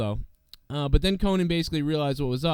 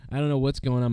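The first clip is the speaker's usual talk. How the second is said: normal talking voice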